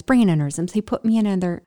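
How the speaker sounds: ordinary delivery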